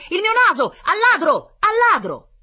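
high, very loud cries of alarm; frantic shouting. A narrator's reading. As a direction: raise the alarm after a thief. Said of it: "gridò il signore".

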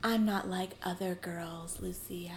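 slow, hushed, unvoiced whisper; very soft put-on intimacy; mock whisper. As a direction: whisper it as a mocking confession. Mocking a cliché in whisper.

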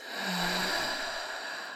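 long breathy sigh out through the mouth; audible exhale. Shown as a demonstration. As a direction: let the breath go in one long, soft whoosh. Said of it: "an audible exhale out through your mouth".

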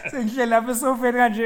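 high, loud words through laughter; laughing. Real, not acted. Guest tells friend about sitting on the sofa, laughing hard. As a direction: crack up while telling it, fast and loud. Laughing while telling the story.

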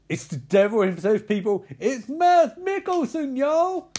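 loud high hype call; excited proclamation. Acted. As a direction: proclaim him like a revival preacher. Announcing Hannibal to audience.

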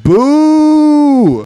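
loud, high, drawn-out boo; imitated jeering crowd; heckling kids. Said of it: "all the kids are like".

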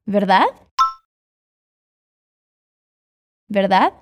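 "Verdade?" lifted sharply at the end like a check; questioning rise. Shown as a demonstration. Teacher modelling "Verdade?" as a question tag for students. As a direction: ask "Verdade?" as a quick confirming question. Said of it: "em forma de pergunta essa palavra".

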